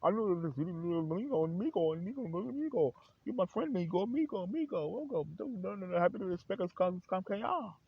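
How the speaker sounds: very high, loud fake Spanish-accented gabble; mock Spanish accent. Trying to talk Spanish to an amigo, mock Spanish accent. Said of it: "I'm trying to talk Spanish".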